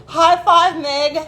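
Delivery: loud, high cheer; a loud, high celebration for a friend; excited cheer. Real, not acted. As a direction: shout it up high with delight. Cheering at Meg.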